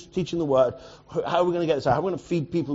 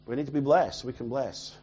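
usual speaking voice

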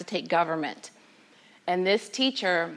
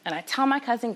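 ordinary delivery, nothing marked

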